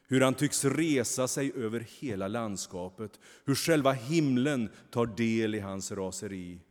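usual speaking voice

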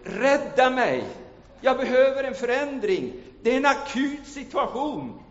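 high, loud plea for rescue; urgent cry. Acted. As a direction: shout for help from the broken ice. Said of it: "Vilket betyder".

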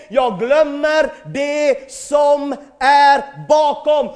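högljudd, ropande predikan som stegras; predikan för full kraft. Acted. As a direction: ropa ut det till församlingen med full kraft. Pastor preaching, building to shouts. (predikant som ropar ut till församlingen, högt och långsamt)

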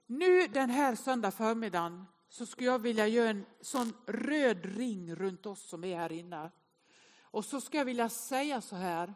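normal talking voice

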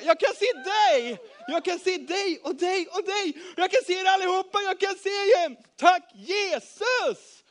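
high, loud cries of joy; jubilant shouting. A narrator's reading. Blind man who can suddenly see, jubilant shouting. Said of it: "Och jubla".

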